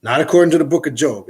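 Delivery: ordinary delivery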